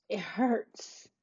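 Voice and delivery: barely voiced, breaking on "it hurts"; choked up. Real, not acted. Retelling the ER scare to viewers, choked whisper. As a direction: let the words catch in the throat, nearly silent. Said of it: "grab myself and get myself together".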